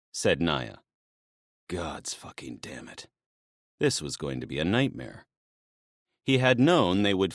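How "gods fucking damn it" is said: breathy whispered curse under his breath. Acted. Dionysus cursing to himself, whispered. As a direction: swear to himself in a hush.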